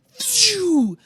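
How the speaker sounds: loud, high whooshing arrow noise. Shown as a demonstration. Game master sounding the arrow let loose, loud whoosh. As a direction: imitate an arrow shooting through the air.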